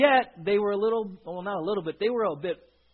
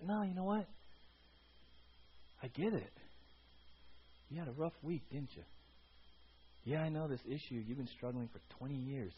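very soft, gentle reassurance; hushed and low, comforting someone who struggles; compassionate understanding; 5 semitones lower, 15 dB quieter than usual. Retold or imitated. Voicing God's compassionate answer to strugglers.

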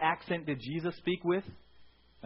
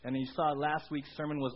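ordinary delivery

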